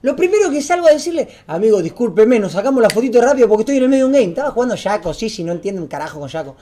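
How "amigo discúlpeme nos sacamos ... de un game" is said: disculpa rápida, más fuerte; excusa apurada. Retold or imitated. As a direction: atropella la excusa para zafar. Retells hurried excuse to fans. (disculpa dicha a las corridas)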